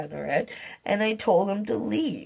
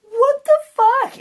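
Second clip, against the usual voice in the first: much higher, louder retold yell; shocked, cursing shout, much higher; startled yell; 11 dB louder than usual. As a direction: yell it as if he just jumped out at you. Repeating her startled outburst.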